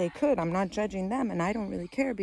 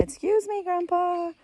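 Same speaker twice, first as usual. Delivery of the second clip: put-on high little-kid voice; imitating polite child; 12 semitones higher than usual. Son asking Grandpa to move, high polite child voice. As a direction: ask nicely in a child's high pitch. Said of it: "I asked Grandpa to move nicely".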